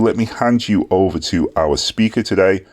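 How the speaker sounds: normal talking voice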